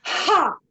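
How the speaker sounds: loud high Ha blasted on the breath out; shouted Ha. Shown as a demonstration. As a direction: shout Ha from the belly.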